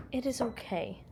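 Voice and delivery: quiet, gentle soothing; softly calm a frightened pet; soft reassurance. Real, not acted.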